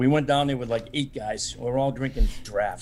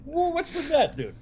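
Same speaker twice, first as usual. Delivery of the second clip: much higher, hammed-up whoa; mock a buddy's shocked reaction; mock surprise; 14 semitones higher, slower than usual. Friends mocking Ricky's odd bar order, high mock surprise.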